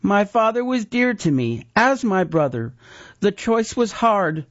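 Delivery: a hard choice owned; resolved sister. A narrator's reading. The sister explains.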